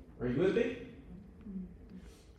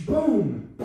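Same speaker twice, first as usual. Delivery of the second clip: louder imitated boom; crash noise; 6 semitones higher, 6 dB louder than usual. Retelling the car crash, louder mock boom. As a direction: belt out a crash sound.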